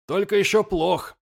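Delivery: high called report; calling out. A narrator's reading. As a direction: shout it across the deck. Lirak calls out to jarl.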